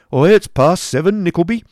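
loud, gruff headmaster voice; Squeers waking him. Acted. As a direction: bark him out of bed. Squeers wakes Nicholas gruffly.

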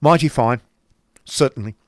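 loud, testy retort; great testiness. A narrator's reading. Uncle answering a pitying mother, very testy. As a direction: cut in crossly, starting high. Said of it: "said Ralph with great testiness".

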